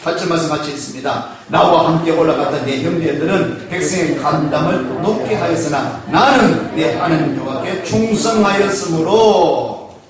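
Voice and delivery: ordinary talk, nothing marked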